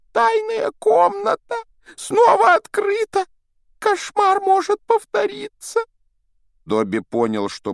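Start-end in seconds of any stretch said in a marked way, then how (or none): 0.1-5.8 slow, loud frantic warning; alarmed elf shout. Acted. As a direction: cry out the secret in terror. Dobby blurts secret in alarm. (испуганный крик эльфа)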